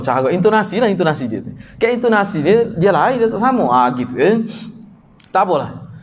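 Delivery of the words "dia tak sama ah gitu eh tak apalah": usual speaking voice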